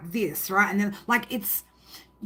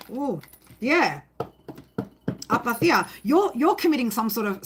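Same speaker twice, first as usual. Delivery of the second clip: ordinary talk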